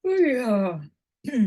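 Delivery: words stretched by a yawn. Real, not acted. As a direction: talk through a big yawn. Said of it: "Sorry about that big yawn".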